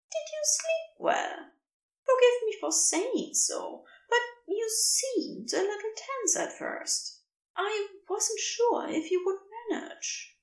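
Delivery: kindly beast checking on him; gentle creature voice. A narrator's reading.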